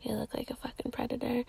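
whispered insult. Retold or imitated. Host whispering what she thought of the creepy man. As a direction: hiss it unvoiced, disgusted. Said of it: "And I'm like".

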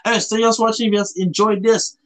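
normal talking voice

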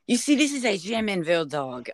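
put-on German accent slipping into French; botched French accent. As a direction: attempt German but land on a French accent. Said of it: "that was a French ants-, accent".

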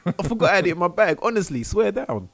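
high, loud, fast excuses; high pleading lad. Pretend. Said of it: "He goes".

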